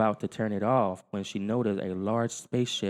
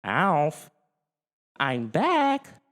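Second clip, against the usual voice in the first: put-on raised, higher girl's call; 8 semitones higher, 4 dB louder, much slower than usual. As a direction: call up the stairs. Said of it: "she called".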